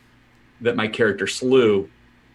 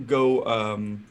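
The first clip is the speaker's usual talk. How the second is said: ordinary delivery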